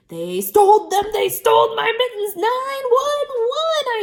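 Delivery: high, loud frantic shouting; girl shouting to her teacher about the theft. A narrator's reading. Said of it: "I shouted some more".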